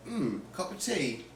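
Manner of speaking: softly imitated London accent. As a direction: mimic a Londoner's accent, quietly. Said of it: "He was from London".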